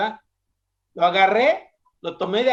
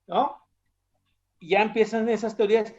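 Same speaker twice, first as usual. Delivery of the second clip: ordinary talk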